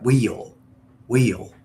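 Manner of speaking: wheel broken into "whee-ul"; two-syllable wheel. Shown as a demonstration. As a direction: stretch wheel with a Southern twang. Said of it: "I made it very, like, two syllable".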